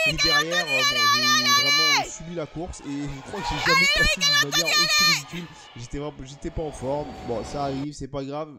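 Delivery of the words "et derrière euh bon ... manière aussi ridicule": sped-up chipmunk voice, much higher and louder; high-pitched squeaky voice. Acted. Comic aside about a bad race.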